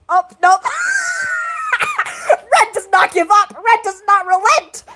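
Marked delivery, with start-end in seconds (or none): 0.7-2.3 long, very high squeal of laughter; shrieking laugh. Real, not acted. Streamer cracking up at the chat, shrieking laugh.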